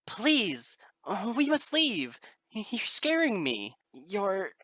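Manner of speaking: high, loud and slow, pleading; terrified begging. Acted. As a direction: beg her to flee, voice cracking with fear. Twilight begs in terror.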